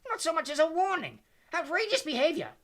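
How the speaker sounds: outraged, high, loud shout; Professor Tofty. A narrator's reading. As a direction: shout in scandalized protest. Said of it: "shouted Professor Tofty".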